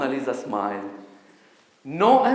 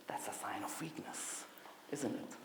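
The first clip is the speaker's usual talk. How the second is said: conspiratorial whisper, much softer; whispered aside; 4 semitones lower, 17 dB quieter than usual. Acted. To the audience about weakness, a conspiratorial whisper. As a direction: whisper it like a secret. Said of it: "sly aside".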